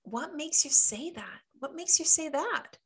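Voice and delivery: high, lilting query; allowed comeback. Shown as a demonstration. Coach shows clients how they can ask back, high and lilting. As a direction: ask it high with innocent curiosity. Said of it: "But you can".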